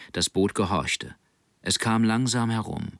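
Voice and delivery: ordinary delivery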